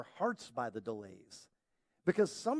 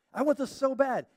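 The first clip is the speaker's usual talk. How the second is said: louder, higher pleading; a whiny demand; mock pleading whine; 7 semitones higher, 7 dB louder than usual. Believer begging God, mock whine. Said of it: "we're like".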